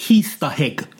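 "Easter egg" in Jamaican accent, H's added: "Heaster hegg". Shown as a demonstration. Co-host announces his segment the Jamaican way, loud Jamaican "Easter egg" with added H's. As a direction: punch out Jamaican "Easter egg" loudly, an H before each word. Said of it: "gonna say it in the Jamaican way".

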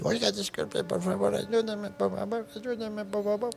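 put-on high, soft old man voice; old man's plea. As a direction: plead like a frail old man. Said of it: "como un viejito".

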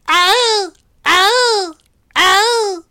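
loud, high peacock screeches; peacock call. Pretend. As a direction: shriek it out like a peacock. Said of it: "an average impression of a peacock".

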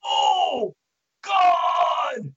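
high, loud cry of pain; just hit in the groin. Shown as a demonstration. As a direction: yelp like you just took a kick to the groin. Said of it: "I'm going to be verbalizing".

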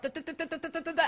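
high staccato clicking sounds; coaster clicking. Acted. Teacher imitating the coaster's climbing clicks, high staccato. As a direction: tick up the lift hill in a high voice.

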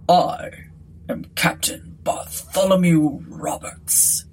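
slow, stately gentleman captain; measured ghostly-captain gentility; gentleman's voice. A narrator's reading. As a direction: introduce yourself grandly as a gentleman. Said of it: "he said in a gentleman's voice".

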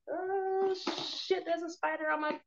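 sudden high startled yelp; real jump-scare exclamation; startled by a spider. Real, not acted. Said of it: "It scared me".

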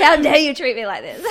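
hammed-up offended star; mocking her post-show ego to friends, laughing, in mock outrage; mock diva outrage. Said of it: "That's like".

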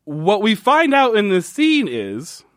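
ordinary talk

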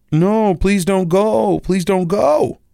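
slow, high, begging voice; pleading app voice. Retold or imitated. App begging him not to cancel, slow high plea. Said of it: "it is now starting to try to tell me".

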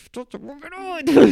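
high, sharp cry of outrage; "Putain, c'est mon vélo" thrown out high and indignant; furious outburst. Retold or imitated. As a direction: blurt it out, stung that it's your own stolen bike. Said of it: "super énervé".

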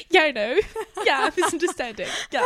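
put-on laughing through a British English accent; playful British English accent imitation. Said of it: "Je l'ai vraiment dit en mode".

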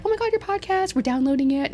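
high-pitched imitation of excited listeners; gushing fans. Conference-goers gushing about the podcast, imitated high excitement.

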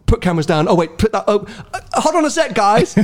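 mock fluster climbing into a high plea; flustered straggler. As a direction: imitate someone scrambling to keep up. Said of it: "and then you're like".

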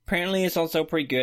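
high, fast, exaggerated parody; mocking tone. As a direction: send up your own words. Mock repeat of own line.

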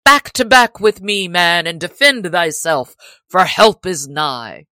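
rally him to fight; rallying shout. A narrator's reading. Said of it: "he cried".

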